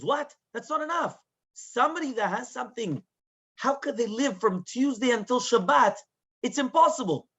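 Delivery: high-pitched disbelief; incredulous objection. Retold or imitated. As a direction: protest as if it is unthinkable. Rabbi rejects her forgiveness, incredulous.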